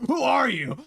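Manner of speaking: imitating a startled buddy; friend's baffled who are you, hammed up; mock confused friend. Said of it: "He's like".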